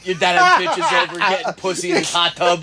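laughing through the words; cracking up mid-sentence. Real, not acted. Laughing while talking.

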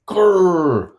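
high, loud mock growl of a woman pushing; straining growl. Wife pushing in labor, a high straining growl. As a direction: growl through the push like in labor.

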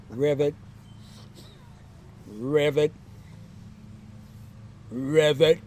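low frog ribbit, rising at the end; low-pitched frog impression; imitated frog croak. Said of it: "I'm a frog".

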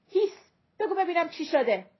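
loud, high shushing question; urgent hushing. Acted. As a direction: cut through the child's screaming. Mother hushing a screaming child.

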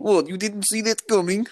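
high, mocking Eastern European accent; bad Russian accent. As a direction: ham up a terrible Russian accent. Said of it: "their accents were terrible as well".

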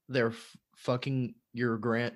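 usual speaking voice

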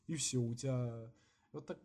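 normal talking voice

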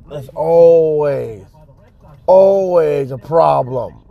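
ALWAYS drawn out long and slow; slow, weary emphasis on always; exasperated complaint. Real, not acted. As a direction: stretch out "always", fed up. Exasperated complaint about always having problems.

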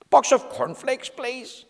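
timid request. Acted. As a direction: ask meekly, voice dying away. Mister Quiet timidly asks grocer.

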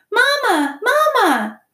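put-on very high, loud baby-bird calls; piping gosling wanting its mother; peeping gosling. A narrator's reading. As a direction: cry out like a tiny chick.

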